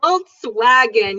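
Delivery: Volkswagen drawn out, lips rounded on the w; slow it down to teach it; slow model word. Shown as a demonstration. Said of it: "So everybody follow me".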